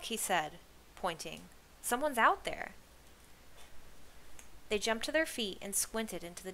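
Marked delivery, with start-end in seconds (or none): 1.8-2.7 higher, urgent sighting; excited alert. A narrator's reading. Kenny spotting a figure in the distance, excited. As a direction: alert the others to a sudden sight.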